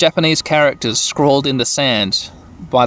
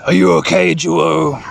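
Ikakumon. Acted. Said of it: "Ikakumon says".

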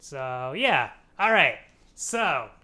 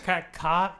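ordinary talk, nothing marked

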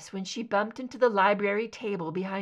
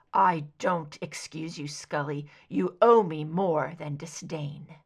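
low intense; 5 semitones lower than usual. A narrator's reading. Mulder holds her, low intensity.

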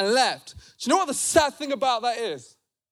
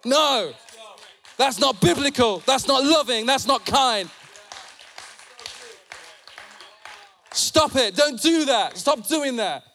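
fired-up sermon, hammering each phrase; impassioned preaching. Real, not acted. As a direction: rebuke the congregation in short, punched phrases. Preaches with force.

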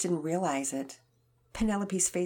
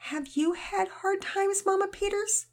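put-on timid girl; 4 dB louder than usual. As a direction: ask the mother shyly.

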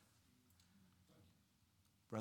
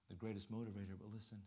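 normal talking voice

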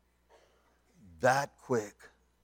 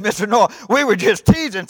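loud, high, anxious backpedalling; acted loud appeal; urgent appeal; 9 semitones higher, 13 dB louder than usual. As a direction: plead your excuse at raised pitch. Daddy pleads to get aboard.

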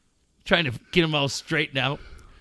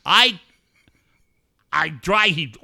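ordinary talk, nothing marked

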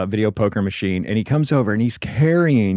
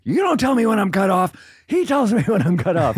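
high-pitched mock indignation; huffy retort; 9 semitones higher than usual. Drunk woman at the bar objects to being cut off, huffy. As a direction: imitate her getting huffy, voice pitched up. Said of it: "she gets all huffy and puffy".

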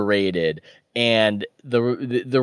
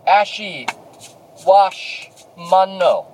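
high stilted robot voice; 7 semitones higher, 3 dB louder, slower than usual. Retold or imitated. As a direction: chop it like a toddler robot. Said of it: "talks like our robot voice".

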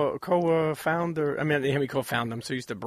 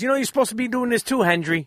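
higher-pitched, quick movie line; imitated film quote, pitched up; quoted movie gangster; 8 semitones higher, 3 dB louder than usual. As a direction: deliver it fast, like a mobster scolding a pal. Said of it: "and he says".

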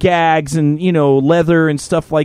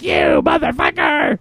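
mock gruff insult; slower than usual. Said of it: "totally like".

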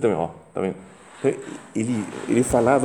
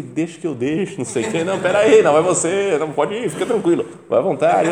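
much higher, louder fussy "after you" voices; mock polite soldiers; 8 semitones higher, 5 dB louder than usual. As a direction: play nervous soldiers pushing each other forward. Paratroopers politely pushing each other.

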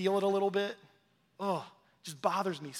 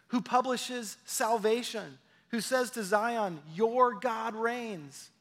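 raised, ringing scripture reading; scripture proclamation; 4 semitones higher than usual. Real, not acted. Preacher proclaiming Isaiah's good news to the congregation, exultant scripture reading. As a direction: proclaim the verse higher, stretching the key words. Reads scripture aloud with force.

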